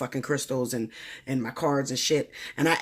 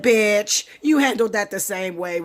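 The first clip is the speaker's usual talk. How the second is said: loud, higher, blunt callout; 5 semitones higher, 6 dB louder, slower than usual. Retold or imitated. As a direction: shout the truth at her. Subscriber calls her out, retold.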